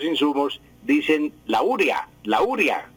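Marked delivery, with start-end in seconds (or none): 1.5-2.9 "urea" stressed on the first syllable, ÚR-ya. Shown as a demonstration. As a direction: hit the U of "urea", let the rest fall away. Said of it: "con acento en la U". ("urea" con acento en la U inicial)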